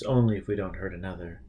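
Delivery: ordinary delivery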